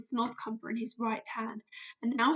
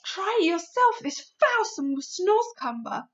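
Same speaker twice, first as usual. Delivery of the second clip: higher, louder giant declaring; BFG, insistent; 9 semitones higher, 7 dB louder than usual. Acted. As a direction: proclaim it as a giant. BFG voice in story.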